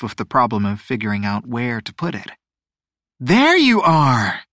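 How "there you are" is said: slow, loud sing-song mother's greeting; happy sing-song. Acted. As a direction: sing it out like a delighted mom. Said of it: "his mom's happy, sing-song voice".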